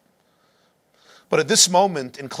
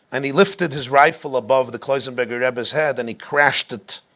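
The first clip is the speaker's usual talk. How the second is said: ordinary delivery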